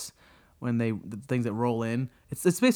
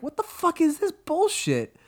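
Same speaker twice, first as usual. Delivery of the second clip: much higher, exasperated shout; exasperated outburst; 14 semitones higher than usual. Retold or imitated. Fan reliving the dumb fight scene, exasperated high shout. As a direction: yell it at the screen in disbelief. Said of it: "I'm like".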